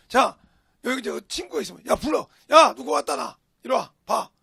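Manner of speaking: loud, brash shout to a friend; re-enacted loud summons; calling out. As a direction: holler them over, acting it out. Acting out calling his friends over.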